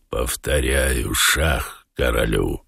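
very low, slow and soft; Воланд. A narrator's reading. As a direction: repeat it low, softly and deliberately. Woland repeats his check to cat. (очень низко, тихо и медленно)

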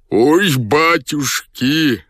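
high, drawn-out wail; slow, high-pitched howl of agony; wailing in pain. A narrator's reading. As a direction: wail like a suffering old-style general. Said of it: "вопил он".

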